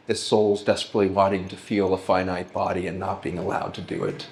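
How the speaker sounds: normal talking voice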